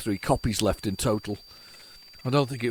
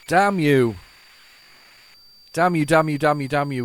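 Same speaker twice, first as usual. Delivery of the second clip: 1 mock curse; 3 semitones higher, 7 dB louder than usual. Real, not acted. Tape fails to load.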